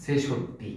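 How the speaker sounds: ছেষট্টি as "se-shot-ti", low and soft; correct s form. Shown as a demonstration. Teacher confirming the spelling's sound, ছেষট্টি with initial s. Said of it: "ছ দিয়ে".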